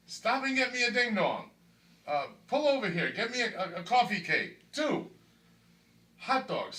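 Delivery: high, loud, greedy orders to the driver; mock craving demands. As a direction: bark out snack orders like a hungry kid. Acting out his old craving orders.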